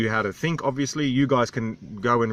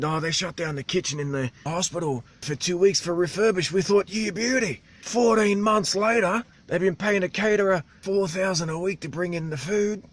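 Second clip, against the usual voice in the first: higher, strained local voice; islander's animated voice; 7 semitones higher than usual. Retold or imitated. Vlogger imitates islander ranting about vests and boots, strained high. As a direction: push the voice up like the animated old islander.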